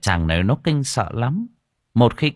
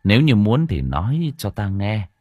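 put-on grave old monk; 4 semitones lower than usual. Monk warns the old woman, low grave voice.

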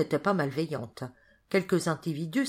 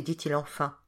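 plain reading voice

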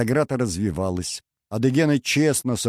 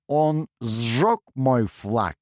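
very slow, higher, word by word; contained rage; 4 semitones higher, much slower than usual. A narrator's reading. Nestor learns of the insult, slowly seething. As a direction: spell out the outrage, each word separate.

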